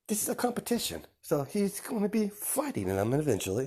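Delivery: put-on gentle mother to child. Kagura soothing child.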